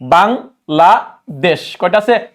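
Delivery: Bangladesh counted out slowly beat by beat; slow counting. Shown as a demonstration. Teacher showing students how to count syllables, slowly. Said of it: "নিজে নিজে ব্যাখ্যা করেন।".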